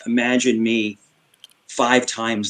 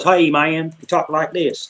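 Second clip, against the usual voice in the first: lightly put-on Virginia country accent, higher pitched; a touch of Southern country twang; country Virginia imitation; 4 semitones higher, slower than usual. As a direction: mimic a Virginia country greeting. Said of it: "Virginia back then was kinda country".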